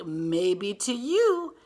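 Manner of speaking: put-on loud, drawn-out kids' retort. A narrator's reading. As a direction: stretch it out like doubtful kids, rising at the end. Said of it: "And these kids say".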